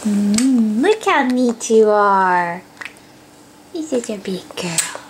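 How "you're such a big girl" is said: soft whisper. Real, not acted. Mom whispers praise to her baby, hushed. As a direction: whisper it tenderly.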